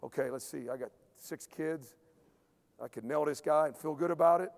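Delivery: low, soft mock muttering to himself; low inner deliberation, half-joking. Player weighing a fight with the coach, low and soft. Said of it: "now you got a little situation".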